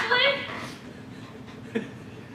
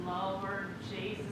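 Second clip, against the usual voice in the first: hushed dismay; 12 semitones lower, 17 dB quieter than usual. Acted. Wife learns she married her cousin, hushed dismay.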